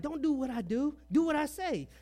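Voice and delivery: high, loud mock parental order; bossy father. Father ordering his son not to copy him, high loud mock scold. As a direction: lay down the law in a squeaky parent voice.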